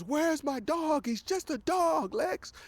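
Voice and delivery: high, strained angry demand; pissed-off demand. Retold or imitated. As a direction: demand the dog back, furious and high. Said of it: "He's pissed off".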